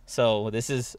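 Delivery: ordinary talk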